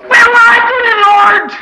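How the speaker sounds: high, loud, slow hollering sermon cry; full-force preaching. Acted. Preacher crying out to the congregation about riches. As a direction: lift them up, crying it out.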